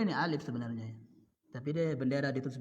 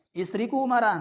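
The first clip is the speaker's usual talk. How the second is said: Istriku marah said higher and louder, marah punched; louder emphatic read of Istriku marah; emphatic example; 7 semitones higher, 8 dB louder than usual. Shown as a demonstration. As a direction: call out the example with force. Teacher voicing angry wife example.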